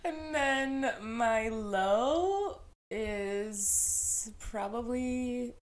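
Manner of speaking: ordinary talk, nothing marked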